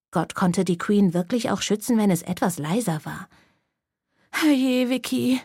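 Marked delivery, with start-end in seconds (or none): 4.3-5.4 mild lament from a harried mom; wailing.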